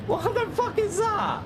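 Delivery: high, shocked what-the-fuck; can't believe what you see; outraged disbelief. Real, not acted. Replay shows reckless driver.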